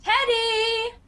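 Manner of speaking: high, loud call up the stairs. Acted. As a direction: call him from across the house. Aunt calling nephew from afar.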